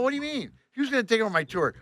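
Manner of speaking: high-pitched baffled protest; acting out a stunned objection; indignant disbelief. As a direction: question it in rising disbelief. Acted protest at losing tour.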